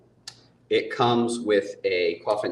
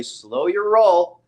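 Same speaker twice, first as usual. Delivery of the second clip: drawn-out, slightly louder warning; retold caution; 5 semitones higher, 4 dB louder, much slower than usual. Host telling over-eager artists to hold back, drawn-out caution. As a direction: stretch it out, higher, to rein someone in. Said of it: "and I'll say".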